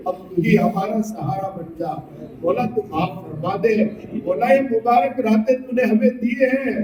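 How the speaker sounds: earnest supplication to God, rising in fervour; pleading prayer. Acted. Preacher begging Allah for mercy for the congregation, pleading prayer. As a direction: plead with Allah for forgiveness, building then sinking low.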